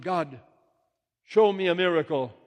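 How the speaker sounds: high, loud call to God; shouted plea. Acted. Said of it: "I shouted".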